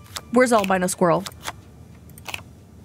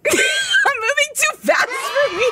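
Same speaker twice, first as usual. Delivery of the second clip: high, slow words squeezed out through laughter; laughing helplessly at herself; giggling as the game outpaces her; slower than usual. Real, not acted. Laughing while playing.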